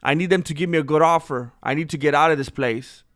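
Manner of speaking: ordinary delivery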